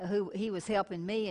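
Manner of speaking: normal talking voice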